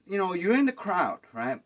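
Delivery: ordinary talk, nothing marked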